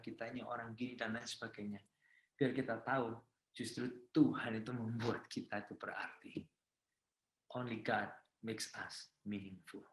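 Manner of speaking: soft, low closing exhortation; hushed, low pastoral sign-off; gentle sermon closing. Real, not acted. Soft closing exhortation and prayer wish.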